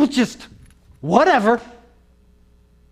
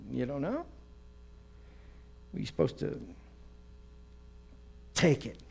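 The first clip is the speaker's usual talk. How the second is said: hushed puzzled; 3 semitones lower, 14 dB quieter than usual. Retold or imitated.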